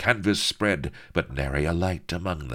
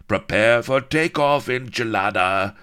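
villain command; 7 semitones higher, 7 dB louder than usual. Acted.

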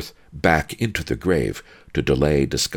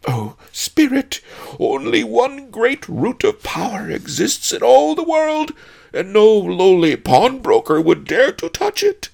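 high, loud terrified sobbing plea; 8 dB louder than usual. A narrator's reading. Lowly pawnbroker begging the spirit to believe him, sobbed. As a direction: sob abjectly before the spirit. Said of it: "Fang sobbed".

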